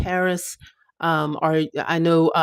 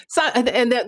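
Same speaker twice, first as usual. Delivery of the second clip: ordinary delivery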